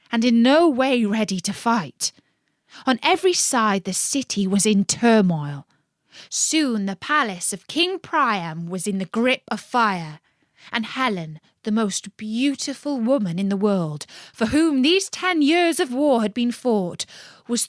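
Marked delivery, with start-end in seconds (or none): none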